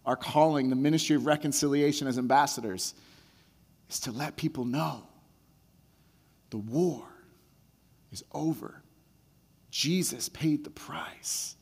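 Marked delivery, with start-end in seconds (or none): 3.9-8.8 softer, spaced-out emphasis; hushed emphasis. Acted. Preacher's hushed climax. 9.7-11.6 slow, whispered declaration. Acted. Hushed sermon declaration.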